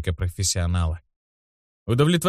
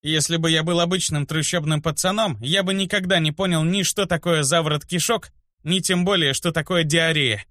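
put-on higher-pitched, caustic boy's retort; venomous; 6 semitones higher than usual. A narrator's reading. Boy mocks the healer's warning, venomous. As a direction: needle her in a raised pitch. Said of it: "Ядовито заметил ей".

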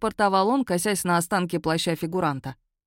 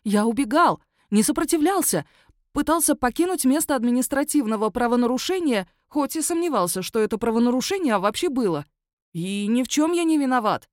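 indignant werewolf; 6 semitones higher than usual. Acted. Cuffed werewolf to the magistrate, high indignant protest. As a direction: plead innocence at the top of the voice.

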